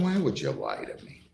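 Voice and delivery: a judge's stunned, pointed question; incredulous. Real, not acted. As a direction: catch him out and demand why he lied. Judge confronts defendant over false name.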